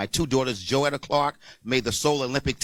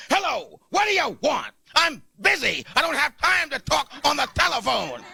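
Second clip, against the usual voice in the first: mock angry phone answer; 12 semitones higher than usual. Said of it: "I'm livid. I'm angry".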